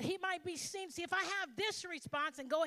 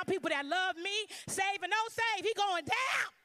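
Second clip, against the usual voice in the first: rising higher and louder threat; building threat; 6 semitones higher, 4 dB louder than usual. Retold or imitated. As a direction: work yourself up to vengeance.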